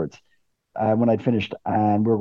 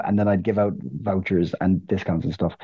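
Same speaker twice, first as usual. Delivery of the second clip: ordinary talk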